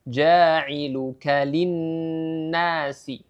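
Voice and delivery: ja'iluka linnasi very slow, nn held two beats; slow recitation demo of linnasi; two-beat ghunnah. Shown as a demonstration. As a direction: stretch the nn of linnasi. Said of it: "Jadi cara membacanya".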